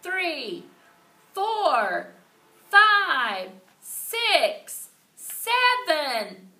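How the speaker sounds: high, loud chant of numbers; shouted counting. A narrator's reading. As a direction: count each rep out like a crowd. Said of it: "room eight kept on counting".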